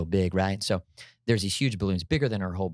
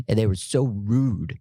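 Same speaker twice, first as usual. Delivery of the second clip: put-on slow, pouty little girl complaint; a lightly voiced hurt nine-year-old drawing it out; 3 dB louder, slower than usual. Daughter telling dad the girls were rude, pouty child voice. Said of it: "in a nine-year-old voice".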